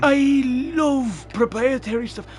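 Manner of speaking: high, slow sarcastic gush; mock adoration. Friend echoing a gripe about proprietary cables, sarcastic gushing. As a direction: draw it out in feigned delight.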